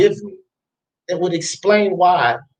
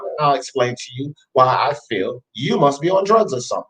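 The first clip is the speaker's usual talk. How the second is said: ordinary talk, nothing marked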